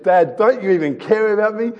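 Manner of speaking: mock-tearful plea of a daughter to her dad; daughter almost in tears. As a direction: imitate a hurt little girl close to crying. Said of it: "almost in tears".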